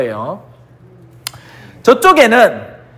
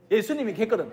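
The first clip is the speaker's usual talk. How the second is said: ordinary talk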